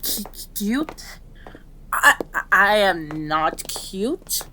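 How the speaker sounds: stammering, indignant denial; flustered. Acted. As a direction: trip over the first sounds, then insist slowly. Flustered at being called cute.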